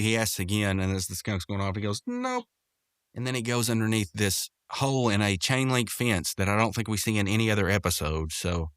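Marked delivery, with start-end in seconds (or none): 2.1-2.4 curt refusal. Retold or imitated. Skunk curtly refuses, walking off.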